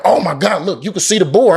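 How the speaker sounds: high, mock-thrilled exclamation; retold excited sighting. Guide points out the boar, retold excitement. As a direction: imitate a guide excitedly spotting the animal.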